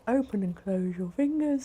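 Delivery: yawning while talking; words stretched by a yawn. Real, not acted. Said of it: "Sorry for yawning".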